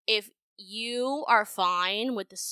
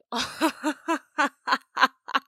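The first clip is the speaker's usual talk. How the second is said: real laugh; 6 semitones higher, 5 dB louder than usual. Real, not acted. Laughs at absurd text.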